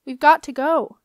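loud, high urgent yell. A narrator's reading. Septimus as the glass cracks, yelling. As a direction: yell it to get them moving. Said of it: "yelled Septimus".

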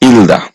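ইলদা said cleanly, no হ sound; teacher models ইলদা; Korean pronunciation demo. Shown as a demonstration. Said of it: "এই শব্দটার সঠিক উচ্চারণ হবে".

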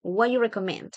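"What you" blended into "wha-you"; reduced question with no "do"; dropped "do". Shown as a demonstration. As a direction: run "What" straight into "you". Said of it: "solamente va a sonar what you".

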